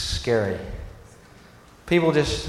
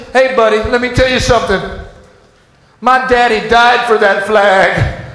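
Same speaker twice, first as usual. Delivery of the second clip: angry patriot; 3 semitones higher, 5 dB louder than usual. Retold or imitated. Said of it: "People were just".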